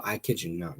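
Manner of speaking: usual speaking voice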